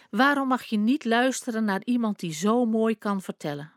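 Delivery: ordinary delivery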